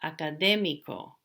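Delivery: "Académico" stressed on "dé"; careful model of "Académico", third-last syllable stressed; stress on accented syllable. Shown as a demonstration. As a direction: lean on "dé" in "Académico", last two syllables light. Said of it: "not on the second to the last".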